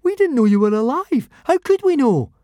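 very high, loud squeak; squeaky voice. Acted. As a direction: squeak desperately. Fishlegs panicking.

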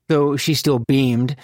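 ordinary delivery, nothing marked

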